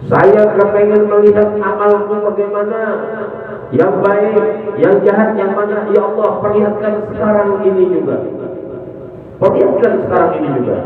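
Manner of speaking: corpse pleading. Acted. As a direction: beg insistently, rushing the last repeat. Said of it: "Teriakan yang ketiga".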